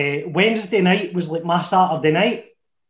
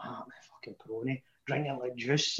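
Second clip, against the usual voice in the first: softer, quick muttered grumble to himself; muttered aside; 4 semitones lower, 14 dB quieter than usual. Retold or imitated. Waiting alone in the bar, muttering quickly to himself. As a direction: mutter it fast under your breath. Inner thought, drinking while waiting.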